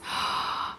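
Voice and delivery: soft airy gasp; hushed breathless gasp; whispered gasp. Real, not acted. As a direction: gasp quietly in awe. Finding accessories.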